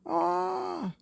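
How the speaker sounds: high short scream of a falling man; startled cry mid-fall. Acted. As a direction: yelp as you drop from the sky. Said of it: "he screamed".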